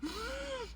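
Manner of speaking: a thin high gasp for air; small strangled intake of breath; imitated gasp. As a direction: mimic the drowning kid's gasp. Shows the kid's breathing.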